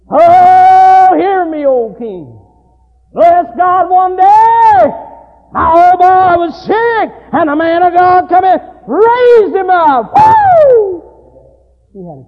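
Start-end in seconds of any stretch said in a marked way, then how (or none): 0.1-10.1 loud, higher hollering mountain preacher. Retold or imitated. Old mountain preacher testifying to the king, shouting. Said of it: "like one old mountain preacher in West Virginia". 10.1-11.0 loud, high whoop; preacher's whoop. Acted. Preacher whooping after the testimony, loud and high.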